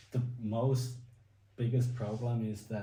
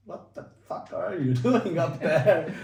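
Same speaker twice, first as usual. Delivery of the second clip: higher, louder laughing disbelief; retold amazed disbelief; 8 semitones higher, 9 dB louder than usual. Jumper retelling his first sight of triples, amused disbelief. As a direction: recall being floored, laughing through the question.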